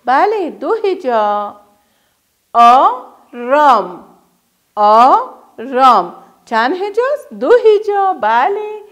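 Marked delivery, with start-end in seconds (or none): 2.5-6.1 drawn out and split into syllables; هجا به هجا و آهسته; slow syllable-by-syllable. Shown as a demonstration. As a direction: model the word slowly for children. Teacher modelling syllables.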